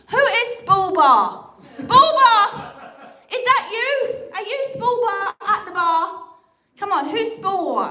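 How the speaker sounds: loud, high-pitched call to the crowd. Real, not acted. Comic to heckler in the bar, calling out.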